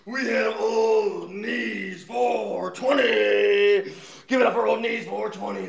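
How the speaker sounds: booming ring announcer intro; grand entrance announcement. Acted. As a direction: hype up the fighter entrance. Introducing contestant like fight.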